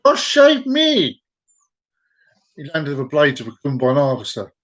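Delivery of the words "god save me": high, drawn-out cry in mock Chinese accent. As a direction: wail it slowly in a crude Chinese accent. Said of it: "The Chineseman jumped out and went".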